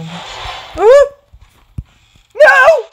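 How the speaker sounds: high loud shriek; frightened scream. Real, not acted. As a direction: scream at the jump scare. Jump scare.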